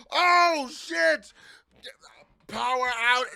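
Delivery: high, loud gasping alarm; panicked surprise. Retold or imitated. Engineer losing a recording, panicked and loud. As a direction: realize the take is lost.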